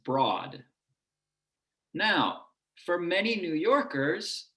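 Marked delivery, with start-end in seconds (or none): 0.1-0.6 "broad" with an open, low vowel; own dialect pronunciation. Shown as a demonstration. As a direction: lower Midwest "broad", close to "brahd". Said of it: "I say it like this".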